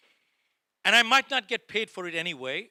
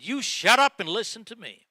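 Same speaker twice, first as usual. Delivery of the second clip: retold manager's telling-off, raised and louder; harsh, impolite rebuke; 4 semitones higher, 6 dB louder than usual. Manager cuts off his excuse-making salesman, harsh and loud. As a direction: snap it louder and higher, imitating a stern boss. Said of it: "He didn't say this politely".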